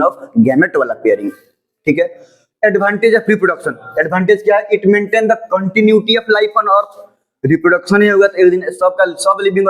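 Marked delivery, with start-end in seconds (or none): 2.6-3.7 slow dictation. Acted. Teacher dictating notes to the class, slow and deliberate. 4.7-7.0 slow dictation. Acted. Teacher dictating notes to the class, slow and deliberate.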